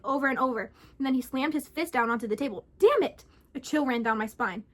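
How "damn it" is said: high, loud angry curse; angry outburst. Acted. Man slams fist on table, cursing angrily. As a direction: explode in frustration.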